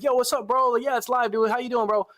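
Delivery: hyped greeting. Retold or imitated. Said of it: "I'm getting excited".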